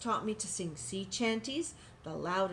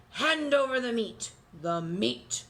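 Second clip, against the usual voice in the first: louder, higher hungry pirate roar; 3 semitones higher, 6 dB louder, slower than usual. Acted. Pirates roaring for meat at dinner. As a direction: demand the meat like a rowdy pirate. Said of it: "we all roared".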